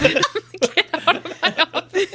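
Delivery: high, squeezed out through laughter; helpless giggle while repeating the gag; laughing. Pretend. Co-host echoes counselor joke, laughing.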